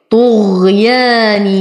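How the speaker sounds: طغيانهم with a clear labial ميم at the end; slow tajweed demo. Shown as a demonstration. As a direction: stretch طغيانهم slowly and sound the ميم clearly. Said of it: "فهو إظهار شفوي". (تلاوة بطيئة مع إظهار الميم)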